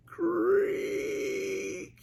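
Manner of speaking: high creaking sound; high creak effect; door creak. Acted. Cellar doors open.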